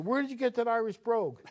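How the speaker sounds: hammed-up Southern drawl, incredulous nun; Virginian drawl. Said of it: "she had this Virginian drawl".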